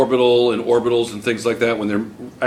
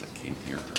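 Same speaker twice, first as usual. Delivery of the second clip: mock faint voice; 10 semitones lower, 20 dB quieter, faster than usual. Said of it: "She's talking like this".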